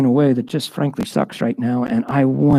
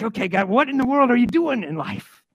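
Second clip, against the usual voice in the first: exasperated prayer; 8 semitones higher, 3 dB quieter than usual. Pretend.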